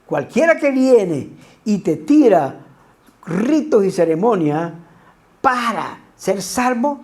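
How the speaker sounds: ordinary delivery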